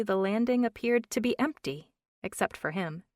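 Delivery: ordinary delivery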